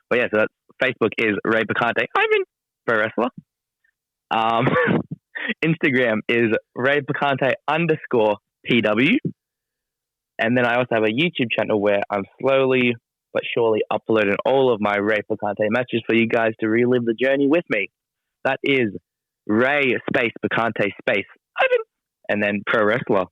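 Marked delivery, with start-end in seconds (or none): 2.2-2.4 high little squeak on one word; squeaky voice. Shown as a demonstration. Wrestler spelling out his social handle, squeaky voice. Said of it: "like, a squeaky voice". 21.6-21.8 high little squeak on one word; squeaky voice. Shown as a demonstration. Wrestler spelling out his social handle, squeaky voice. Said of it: "like, a squeaky voice".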